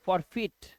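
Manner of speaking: forfeit said "for-feet"; ee sound. Shown as a demonstration. Teacher contrasting forfeit with eye words, ee sound. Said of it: "इसे ई की आवाज है".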